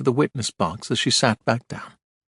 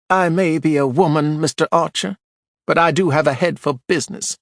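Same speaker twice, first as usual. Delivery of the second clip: imperious, proud, higher; haughty; 6 semitones higher, 3 dB louder than usual. A narrator's reading. Widow boasting to Archer, imperious. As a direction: look down your nose at him.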